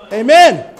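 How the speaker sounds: loud, punchy amen; a loud shout of amen. Real, not acted. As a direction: hit the amen hard, loud.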